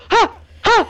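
high startled laughing gasp; shocked laugh. Retold or imitated. Girl waking to blood, a high shocked laugh. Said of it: "and I was like".

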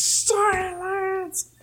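very high, drawn-out comic scream; hammed-up high-pitched shriek; mock scream. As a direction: imitate a cartoon villain screaming an order. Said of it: "Bowser just screams".

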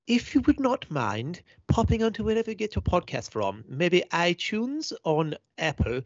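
a playful, hammed-up posh English outro read; posh English accent. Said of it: "Can you do it in a posh accent".

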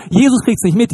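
normal talking voice

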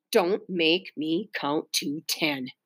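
low, slow, soft threat; quiet warning. A narrator's reading. Mother warns misbehaving daughter, very quietly. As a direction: drop low and space out the words softly. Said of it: "said Mother even more quietly".

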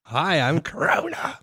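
high, slow put-on creepy greeting; silly virus voice. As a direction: introduce yourself as the virus, hammed up.

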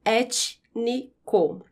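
"Étnico" very slow, ét, ni, co; syllable by syllable. Shown as a demonstration. Teacher putting T and N apart, syllable by syllable. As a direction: pause after each syllable of "Étnico". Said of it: "quando eu separo essas sílabas".

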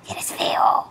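creepy whisper from a tiny monster. Shown as a demonstration. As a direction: hiss it like a little monster. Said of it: "estoy tratando de hacer mi voz de monstruo".

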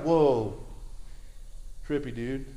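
mock amazement, dropping away; awed reaction. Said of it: "you're like".

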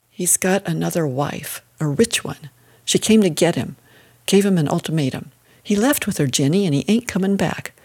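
ordinary delivery, nothing marked

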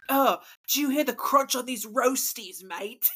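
put-on muddled British-Australian accent; bad British accent. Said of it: "That sounds more Australian than anything".

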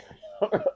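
short unvoiced laugh at his own joke; breathy laugh. Real, not acted. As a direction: let out a quick breathy laugh.

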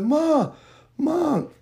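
high-pitched, drawn-out calling out; retold yell for mom. Crying child searching for mom, retold yelling. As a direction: reenact a child calling far down the street. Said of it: "yelling for my mama name".

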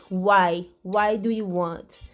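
ordinary delivery

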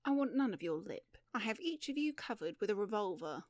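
usual speaking voice

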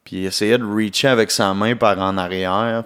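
ordinary talk

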